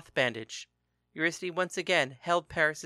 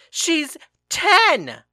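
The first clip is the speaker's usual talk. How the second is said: very loud, much higher shriek of outrage; outraged shout; 14 semitones higher, 12 dB louder than usual. A narrator's reading. Father shouting that his daughter is only ten, outraged. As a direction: scream it in disbelief.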